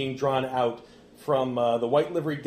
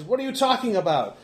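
raised, higher-pitched questioning; exasperated demand; 9 semitones higher, 5 dB louder than usual. Acted. Remy answers the gloating Froman, exasperated demand. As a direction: demand an explanation.

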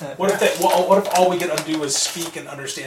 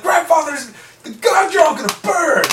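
shouting zealot; 13 semitones higher than usual. Acted. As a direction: yell it at the heretics. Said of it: "I'm like".

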